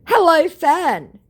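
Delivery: loud, high, slow mock-grand hello; hammed-up scammer greeting. Fake celebrity greets a fan, hammed-up grand.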